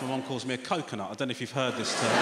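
ordinary talk, nothing marked

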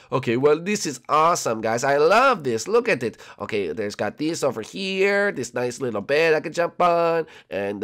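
ordinary talk, nothing marked

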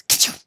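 loud, explosive mock sneeze; acted sneeze. Joe imagining Billy sneezing on pepper, explosive mock sneeze. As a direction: burst out with a fake ka-chow. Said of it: "all of a sudden he'd sneeze".

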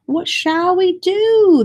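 high, slow mock wail; a drawn-out worried wail from the crowd; villagers wailing. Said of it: "the villagers wailed".

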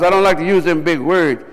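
ordinary talk

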